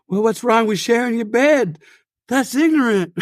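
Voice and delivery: high-voiced pop star impression. Shown as a demonstration. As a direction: protest in a high, put-on star voice.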